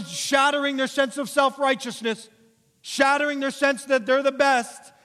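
very high, loud sermon crescendo; impassioned full-force preaching. Real, not acted. Preacher telling the room all are guilty, impassioned full-force preaching. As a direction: challenge the whole room at full voice.

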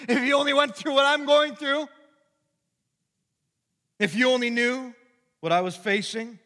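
high, loud, self-pitying whine; grumbling complainer. Retold or imitated. Preacher acting out a grumbler accusing a brother, loud and whiny. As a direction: play the aggrieved sufferer accusing a brother. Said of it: "It goes like this".

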